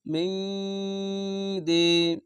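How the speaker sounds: মিং দি with the ng hummed long before দি; ikhfa ghunna. Shown as a demonstration. Teacher demonstrating ikhfa ghunna, মিং held in the nose before দি. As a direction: hold the nasal in মিং, then release দি. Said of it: "এঠে ইকফা গুন্না".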